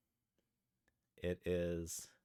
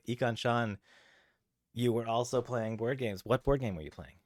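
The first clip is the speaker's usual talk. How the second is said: normal talking voice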